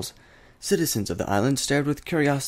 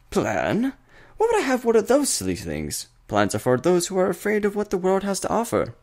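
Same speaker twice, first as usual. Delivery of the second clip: much higher, louder, theatrical mocking voice; gleeful trickster; 11 semitones higher, 4 dB louder than usual. A narrator's reading. Discord laughs off the princess's question about plans, gleeful and theatrical. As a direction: scoff at plans with mad glee.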